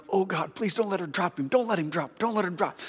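high, hushed frantic begging; mock pleading. Pastor praying his secretary won't drop the call, panicky pleading. Silently begging the transfer works.

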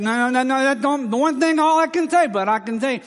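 thick-tongued, flustered protest, imitated; Dutchy voice. Student correcting the girl, high-pitched Dutchy voice. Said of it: "his Dutchy voice".

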